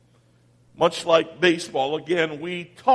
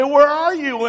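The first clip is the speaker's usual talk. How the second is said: higher, louder call to God; exasperated cry; 8 semitones higher, 6 dB louder than usual. Retold or imitated. Believers calling out to God, higher and louder. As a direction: call out in frustration. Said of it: "we say".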